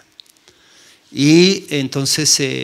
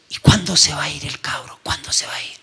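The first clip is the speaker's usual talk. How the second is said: retold argument in a whisper; an angry uncle, voiced as a whisper; hushed arguing. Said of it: "mi tío discutiendo con ella".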